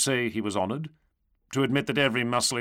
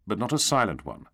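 plain reading voice

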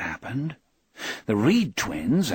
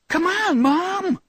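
put-on very high girlish call; calling back; 3 dB louder, slower than usual. A narrator's reading. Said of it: "she called back over her shoulder".

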